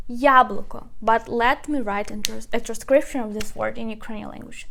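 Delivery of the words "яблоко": "Яблоко" with a strong opening Я; stress on first syllable. Shown as a demonstration. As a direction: stress the Я of "Яблоко", let the rest fall. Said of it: "We put stress on the first syllable".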